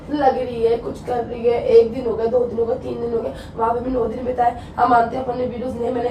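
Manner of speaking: low, soft, dejected listing; weary hurt. Real, not acted. Wife lists days of being neglected, wearily. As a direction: count off grievances quietly, voice sunk low.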